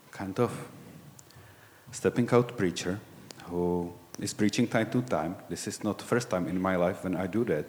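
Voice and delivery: normal talking voice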